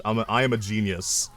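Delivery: ordinary talk, nothing marked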